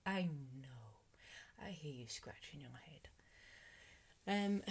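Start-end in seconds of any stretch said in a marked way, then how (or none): 1.6-3.1 breathy whispered aside. Real, not acted. Vlogger whispering an aside to her viewers, hushed. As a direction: lean in and whisper to the listener.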